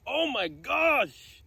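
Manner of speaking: very high, drawn-out oh my gosh; stunned joy. Real, not acted. Detectorist reacting to a rare coin, high stunned gasp. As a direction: let it float up in disbelief. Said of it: "I'm freaking out".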